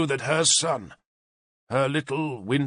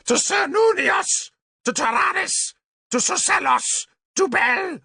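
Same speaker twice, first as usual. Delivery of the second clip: ritual shout. A narrator's reading. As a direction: scream the god's name over the fires. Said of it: "Tanabor shouted".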